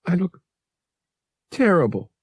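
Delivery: high, halting, sniffly self-reproach; tearful. A narrator's reading. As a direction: say it miserably, with a break before the last word. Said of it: "she sniffled".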